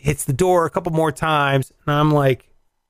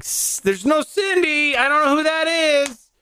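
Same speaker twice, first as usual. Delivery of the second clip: nervous denial; 10 semitones higher than usual. Retold or imitated. Guest answering the pimp through the door, high nervous imitation. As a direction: act out a nervous plea of ignorance.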